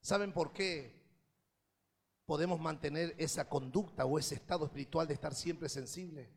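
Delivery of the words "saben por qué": ordinary talk, nothing marked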